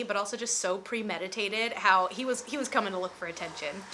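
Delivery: usual speaking voice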